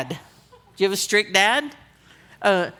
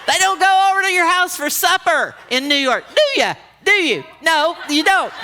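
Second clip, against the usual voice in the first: high, loud, incredulous protest; exasperated and raised, playing to the crowd; comic indignation; 8 semitones higher, 4 dB louder than usual. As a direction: call it out in mock outrage. Comic outrage at husband's invitation.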